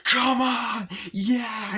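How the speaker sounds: high, loud, reckless insistence; defiant yelling. Acted. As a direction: yell it like a cocky daredevil. Boy insisting he can dodge it.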